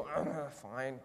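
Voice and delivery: low, grudging groan of agreement; mock a reluctant kid giving in, softly; grumbling kid. Kid told to clean their room, grumbling. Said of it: "they grumble".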